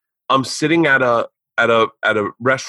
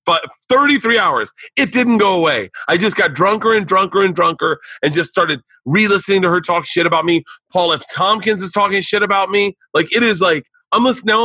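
building indignation, voice rising higher; worked-up retelling; 7 semitones higher than usual. Real, not acted. Telling friends about a flight-long feud, higher and worked up. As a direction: pitch climbing higher with outrage.

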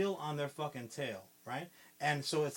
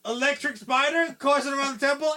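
much higher, louder gleeful burst; excited pitch; 10 semitones higher, 10 dB louder than usual. Real, not acted. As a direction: sell the idea with giddy excitement. Gleeful pitch of spider idea.

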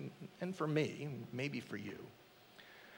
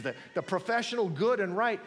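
normal talking voice

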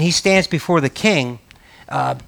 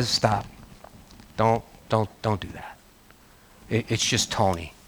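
quiet firm refusal; 7 semitones lower, 13 dB quieter than usual. Retold or imitated. Firmly refusing flattery.

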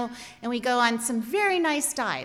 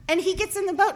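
ordinary delivery

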